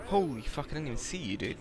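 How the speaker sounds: usual speaking voice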